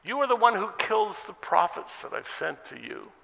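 high, loud cry to a city; imitated anguished appeal; impassioned lament. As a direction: call out in reproach, pitched well up. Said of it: "He even did it to the city of Jerusalem".

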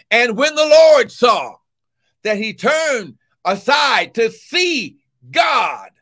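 slow, loud scripture proclaimed; preached scripture reading. Acted. Preacher reading Exodus to congregation, loud and slow. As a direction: declaim the verse to the church.

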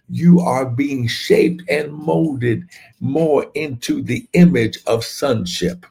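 ordinary delivery

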